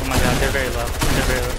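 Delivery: loud excited callout; excited shout. Real, not acted. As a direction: yell it out to the team. Spots weak enemies.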